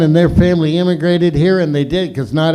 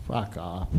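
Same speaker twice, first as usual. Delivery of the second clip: ordinary talk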